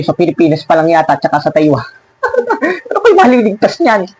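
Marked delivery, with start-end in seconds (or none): 2.2-2.9 high, soft giggle; mocking chuckle. Real, not acted. Preacher scoffing at a rival church's small reach, soft mocking giggle. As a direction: let out a light, high snicker.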